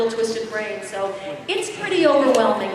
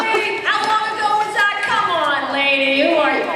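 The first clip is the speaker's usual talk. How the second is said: mock skeptical fan, pitched higher; 7 semitones higher, 3 dB louder than usual. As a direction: tease her like a doubting fan. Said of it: "I'm gonna go".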